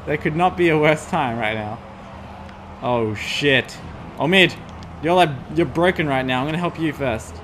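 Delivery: normal talking voice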